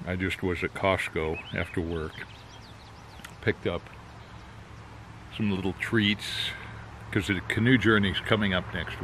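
talking around pipe stem; speaking through clenched teeth; pipe in mouth. Real, not acted. Seen 2: Pipe stem clenched between his teeth throughout the labelled words.

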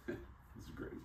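a soft, breathless whisper of delight; whispered glee. Retold or imitated. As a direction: whisper it like a secret win. Gleeful aside about first class.

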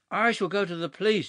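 high, loud burst of sudden energy; shouted. A narrator's reading. Huddle threatens Clovis with the police, shouting high. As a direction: blurt it out with new force. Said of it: "shouted Huddle with sudden energy".

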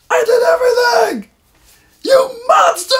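very high, loud accusing yell; mock tantrum yell. Crying boy yelling at his uncle, tantrum. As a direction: scream it through childish tears.

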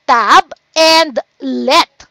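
"tab", "and", "let" slow and set apart; syllable by syllable. Shown as a demonstration. Teacher asking how many syllables, parts slowly separated. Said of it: "first syllable tab".

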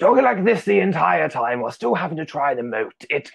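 a hint of the War Doctor while explaining; John Hurt voice. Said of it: "It was a John Hurt voice".

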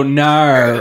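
No as "naur", with an R and W glide; Australian no. Retold or imitated. Friend showing how Australians talk, No as "naur". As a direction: bend No into Aussie "naur". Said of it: "They go".

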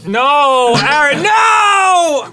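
high, drawn-out desperate yell; shout. Real, not acted. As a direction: shout him down, slowly. Friend combines gross drinks.

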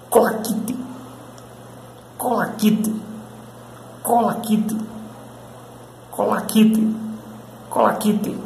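Colaquite as KOH-lah-KEE-tee, clearly syllabled; English word collect. Shown as a demonstration. Teacher models Colaquite for Brazilian viewers, clear and slow. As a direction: spell out Colaquite slowly, each syllable distinct. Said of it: "A pronúncia dessa palavra".

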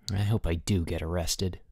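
low, subdued, grim resignation. A narrator's reading. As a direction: say it grimly, low and soft. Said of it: "said Boots grimly".

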